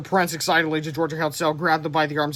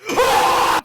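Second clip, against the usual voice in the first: much higher, loud, airy shriek; shrill scream; 7 dB louder than usual. Acted. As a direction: scream out in fright. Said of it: "screamed Tippy".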